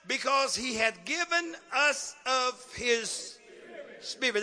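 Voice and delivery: very slow, spaced-out scripture reading; word-by-word emphasis. Real, not acted. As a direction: stretch each word and pause between them.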